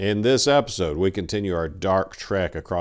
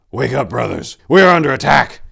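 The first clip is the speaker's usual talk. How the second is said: loud urgent warning cry, pitched up; battle shout; 6 semitones higher, 11 dB louder than usual. Acted. Tor waking his brothers in the tent, loud battle shout. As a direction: rouse sleeping men to arms.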